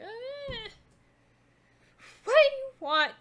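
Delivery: high keening moan swelling into a loud cry; keening old woman. Acted. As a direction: wail it like a crazed old crone. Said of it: "keening noise".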